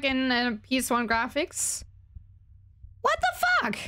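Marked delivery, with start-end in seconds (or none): 3.0-3.8 high startled outburst; jolted, blurting it out; shocked. Real, not acted. Sudden shock in game.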